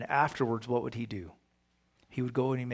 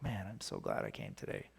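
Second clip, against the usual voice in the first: mock excitement; 4 semitones lower, 11 dB quieter than usual. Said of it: "So excited".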